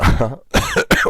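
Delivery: brief chuckle to oneself; short laugh. Real, not acted. Teacher mid-demonstration to students, short chuckle. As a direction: let out a quick breathy laugh. Slip while typing OR formula.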